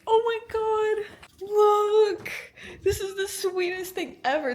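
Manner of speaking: high, loud, drawn-out gush; gushing squeal. Real, not acted. Showing viewers a fan's gift, high gushing squeal. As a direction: squeal it, stretching the words.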